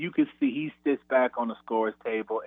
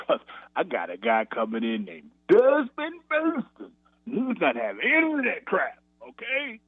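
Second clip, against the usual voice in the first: coach taunting; 7 semitones higher than usual. Retold or imitated. Coach needling player about freshman.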